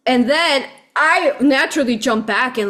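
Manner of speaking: ordinary talk, nothing marked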